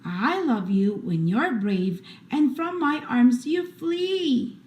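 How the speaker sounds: ordinary delivery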